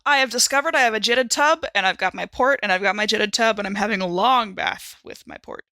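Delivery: ordinary talk, nothing marked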